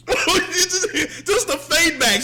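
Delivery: high, loud laughing words; real laughter over the words. Real, not acted. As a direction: say it through helpless laughter. Cracking up at the scene.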